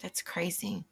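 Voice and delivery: hushed whisper. Real, not acted. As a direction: breathe it out in disbelief. Stunned by news of shooting.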